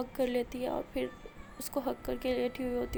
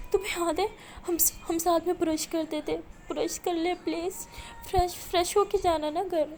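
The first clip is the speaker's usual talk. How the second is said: higher, louder wistful pleading, slowing; tearful coaxing; 5 semitones higher, 4 dB louder than usual. Acted. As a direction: coax her gently with a memory. Maya coaxing Aliya to stay. (التجا بھرا اصرار)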